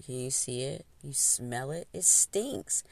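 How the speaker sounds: usual speaking voice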